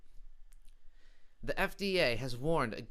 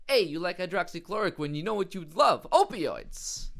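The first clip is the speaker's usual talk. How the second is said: higher, louder shady sales pitch; drug dealer pitch; 6 semitones higher, 7 dB louder than usual. Pretend. Comedian casting the doctor as a dealer, sly and pushy. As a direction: push the goods like a street dealer. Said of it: "sounds like a drug deal".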